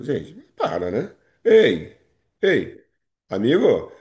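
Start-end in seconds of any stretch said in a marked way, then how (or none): 1.4-3.9 mock call-out. Teacher calling out mock warning.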